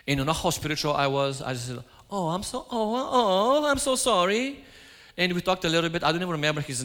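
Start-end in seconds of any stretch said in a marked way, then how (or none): 2.1-4.6 high, slow, hammed-up "oh, oh, oh, I'm so sorry"; gushing mock sympathy. Preacher retells how he gushed at a stranger, hammed-up. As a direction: stretch out an over-the-top, helpless sorry.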